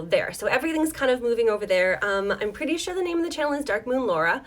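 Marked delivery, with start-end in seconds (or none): none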